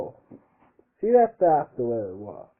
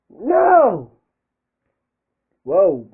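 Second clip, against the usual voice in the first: surprised cry; 9 semitones higher, 13 dB louder than usual. Real, not acted. Reacting to mistake in reading.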